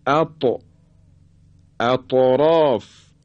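"اط، اطراف" with ط bounced audibly; qalqalah on ta. Shown as a demonstration. Teacher demonstrating the letter ta, bouncing the ط in "اطراف". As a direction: recite "اطراف" slowly, releasing ط with a short echo. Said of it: "حرف تا".

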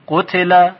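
قتل voiced with short u, i, a; read as "qutila". Shown as a demonstration. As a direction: say قتل as qu-ti-la, three short syllables. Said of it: "یا اینکه بخونیم".

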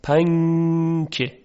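پنکه drawn out very slowly, with the نون nasalized and hidden; slowed-down پنکه with a hidden نون. Shown as a demonstration. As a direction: stretch پنکه slowly and let the نون hum.

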